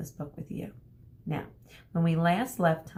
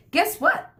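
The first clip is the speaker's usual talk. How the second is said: high, loud eager announcement; excited dad teasing a surprise; excited news; 10 semitones higher, 8 dB louder than usual. Retold or imitated. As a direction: call it out like a dad with big news. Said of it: "my dad said one day".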